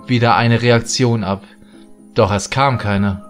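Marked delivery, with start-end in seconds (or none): none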